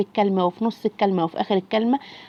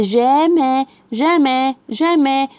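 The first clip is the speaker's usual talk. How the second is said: slow blending; 3 semitones higher, much slower than usual. Shown as a demonstration. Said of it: "teacher blends syllables repeatedly".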